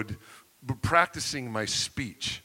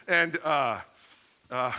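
ordinary talk, nothing marked

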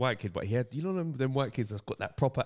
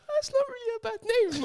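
high shouted feeble excuse; pathetic defensive squeal. Retold or imitated. Son justifying fake name to his mum. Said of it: "I'm like".

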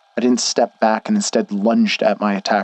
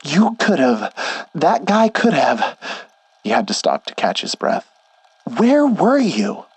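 higher, breathless and alarmed; winded worried friend; 7 semitones higher than usual. Acted. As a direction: gasp out the worry. Schnee out of breath, upset.

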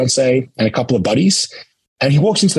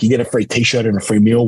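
ordinary talk, nothing marked

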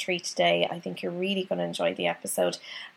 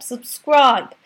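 loud, higher mock Katie Price cry of Subscribe!; 4 semitones higher, 11 dB louder than usual. Host mimicking Katie Price to urge listeners, loud. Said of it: "much like Katie Price".

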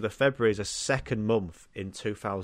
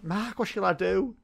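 mock pleading contestant; 7 semitones higher than usual. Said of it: "Linda's like".

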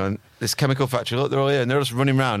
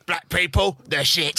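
higher, put-on old-school comic voice; Jim Davidson impression; 6 semitones higher, slower than usual. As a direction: ham up a brash club comic. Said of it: "he's on there just going".

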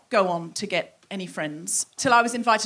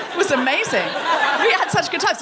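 high, louder, hammed-up enthusiasm; exaggerated gushing; 6 semitones higher, 4 dB louder than usual. Comedian parodying her classmate's fond memories, over-the-top gushing. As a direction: stretch it out in a mocking high gush. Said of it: "No, she didn't say it like that".

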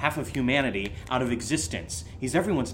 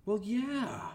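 much higher, proud silly voice; goofy high voice; 9 semitones higher than usual. Acted. Friend proudly explains the double steak stakeout, high and silly. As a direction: sell the pun like it's genius.

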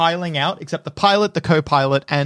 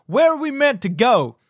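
high, raised officer's demand; commanding young officer; 6 semitones higher than usual. Acted. Lieutenant turning to the young soldier leading them, commanding. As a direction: call the question out with authority.

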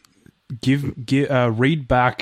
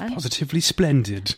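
higher-pitched mock British praise; mock British accent; 4 semitones higher than usual. Co-host approving the coffee order, mock British accent. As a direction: put on a British accent, playfully. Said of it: "feels like a positively th-, moment".

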